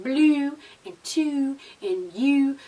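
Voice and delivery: slow, mimicked Baltimore vowels on blue, two, you; Baltimore accent. Shown as a demonstration. Vlogger showing what irritates her soul, mock Baltimore accent. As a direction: stretch each "oo" like a Baltimore speaker. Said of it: "Baltimore, y'all accent".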